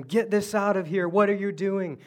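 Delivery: loud, high, angry shout at the crew; angry yell. Retold or imitated. Frustrated fisherman yelling at his crew. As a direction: bark it at the useless crew. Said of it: "he's yelling at them".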